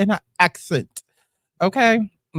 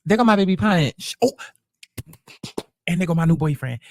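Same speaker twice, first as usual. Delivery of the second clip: usual speaking voice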